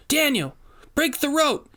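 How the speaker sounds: high urgent yell. A narrator's reading. Rainey yelling to Daniel while wrestling Boswell, high yell. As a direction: shout it while wrestling someone down. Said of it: "she yelled".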